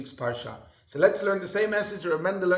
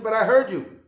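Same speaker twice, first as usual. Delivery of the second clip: louder, sharper accusation; a guard snapping back at lying prisoners; insistent guard shout; 4 semitones higher, 7 dB louder than usual. Retold or imitated. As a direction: call him out like you caught him.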